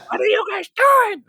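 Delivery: very high suspicious mom voice; mock mom walking in; imitating mom. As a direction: squeak it like a startled mother. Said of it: "She goes".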